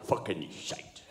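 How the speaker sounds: low, quiet Scottish mutter; Scottish grumble. Pretend. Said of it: "I'm just gonna go".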